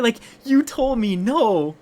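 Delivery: high, loud, drawn-out complaint; exasperated protest. Retold or imitated. Boy reminding her she refused, exasperated protest. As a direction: protest loudly in mock outrage.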